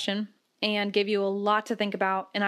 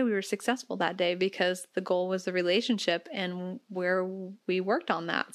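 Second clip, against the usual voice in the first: normal talking voice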